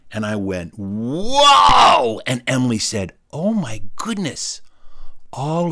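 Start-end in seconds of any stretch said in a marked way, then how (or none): 0.8-2.2 long, loud rising whoa; wide-eyed kid's drawn-out exclamation; amazed. Acted. Boy gasps at the sight. 3.3-4.6 slow, higher astonished voice; imitated schoolgirl awe; shocked. Girl shocked by the sight.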